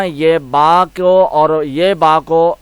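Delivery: ordinary delivery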